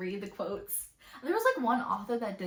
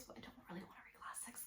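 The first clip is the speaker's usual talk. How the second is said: breathy secret whisper, much softer; whispered aside; 3 semitones lower, 22 dB quieter than usual. Shown as a demonstration. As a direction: confess it in a hushed whisper.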